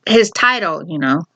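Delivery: normal talking voice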